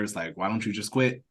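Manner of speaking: ordinary talk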